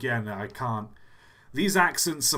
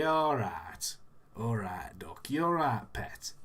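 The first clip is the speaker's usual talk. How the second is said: put-on casual northern English accent; 3 semitones lower, slower than usual. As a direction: imitate a northerner in a Yorkshire-style accent. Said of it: "them in the north".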